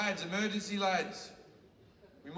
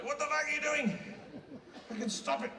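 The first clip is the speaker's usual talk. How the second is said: angry Jesus shouting; 8 semitones higher than usual. Retold or imitated. Jesus yelling down from the cross, outraged. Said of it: "And he's up there".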